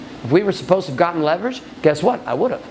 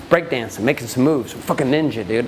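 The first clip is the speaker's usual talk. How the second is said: ordinary delivery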